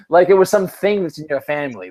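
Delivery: usual speaking voice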